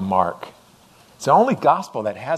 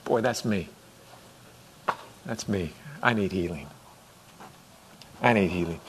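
quiet confession; 5 semitones lower, 6 dB quieter than usual. Retold or imitated. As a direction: lower and softer, a humbled admission. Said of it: "I went".

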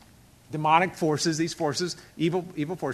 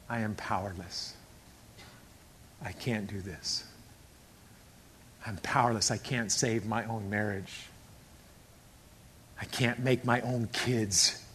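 low, very soft, slow confession; subdued admission; 10 semitones lower, 12 dB quieter, slower than usual. Acted. Member reciting the first step of recovery, subdued. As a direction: admit defeat quietly, with long pauses.

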